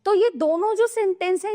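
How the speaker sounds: ordinary talk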